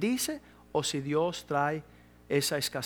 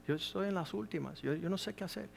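quiet weeping plea; 10 dB quieter than usual. Retold or imitated. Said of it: "ella estaba llorando y decía".

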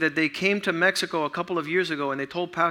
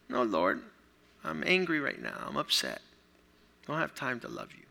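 much softer, lower, grumbling refusal; sulky Peter; 5 semitones lower, 9 dB quieter than usual. Acted. Peter brushing off Jesus, low soft sulky answer. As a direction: mutter it like a sulking disciple.

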